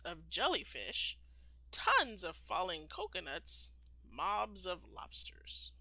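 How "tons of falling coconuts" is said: plain reading voice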